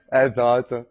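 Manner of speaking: bursting out laughing, loud and drawn out. Real, not acted. Host getting the caller's happy prisoner joke, laughing hard. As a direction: laugh loudly through the words, real amusement.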